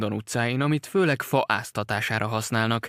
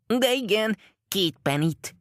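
a lightly voiced eager small boy, higher; little boy; 9 semitones higher, slower than usual. A narrator's reading. Small boy quickly asks Holmes for two pennies, lightly higher. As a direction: pipe up like a greedy child.

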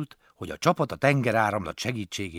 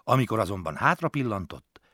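usual speaking voice

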